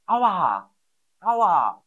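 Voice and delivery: high, loud mock cry of pain; pained ouch. Shown as a demonstration. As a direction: act out hurting yourself. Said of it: "sagt man Aua".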